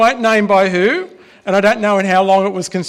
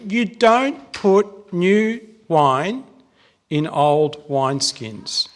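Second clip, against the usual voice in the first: slow emphatic quote; much slower than usual. Acted. Quoting Jesus' saying slowly.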